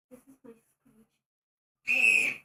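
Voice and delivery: slow, drawn-out shrieking screech. Shown as a demonstration. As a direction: screech it out slowly like a dying hamster. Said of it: "Wanna hear my new screech?".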